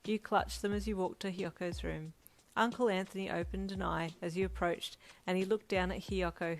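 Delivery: plain reading voice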